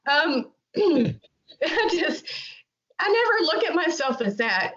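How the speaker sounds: slow, real catch of emotion in the voice; fighting back tears as she answers; choked up for real, slowed right down; getting emotional. Real, not acted. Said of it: "I'm sorry, I'll get emotional".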